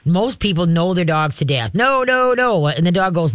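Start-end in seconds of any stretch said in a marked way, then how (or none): 1.7-2.7 higher-pitched, drawn-out nos; mock nagging owner. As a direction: imitate an owner endlessly scolding the dog. Imitating owners nagging their dogs.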